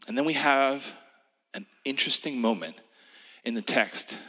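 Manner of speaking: ordinary talk, nothing marked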